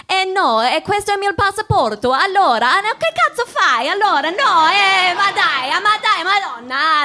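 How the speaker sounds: high, hammed-up Italian outburst; exaggerated indignant Italian; over-the-top Italian. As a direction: protest wildly in sing-song Italian. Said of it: "I just go super over the top".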